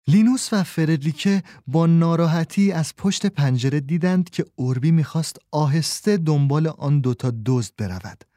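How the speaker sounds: ordinary delivery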